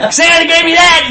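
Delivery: put-on high, loud kid's boast; excited shout. Boy boasts about his gift, high and loud. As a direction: crow about it like a delighted kid.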